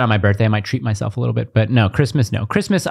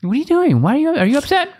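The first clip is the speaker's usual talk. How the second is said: puzzled parent; 14 semitones higher, faster than usual. Real, not acted. Parent questioning crying toddler.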